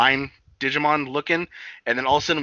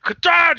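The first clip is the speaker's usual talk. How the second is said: explosive high, loud "kata"; sudden shouted sound effect; imitated spitting blast; 12 dB louder than usual. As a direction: burst it out like a blowhole spewing. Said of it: "he just, like".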